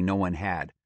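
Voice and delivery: ordinary delivery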